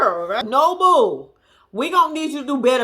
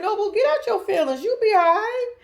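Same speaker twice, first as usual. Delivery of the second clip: ordinary talk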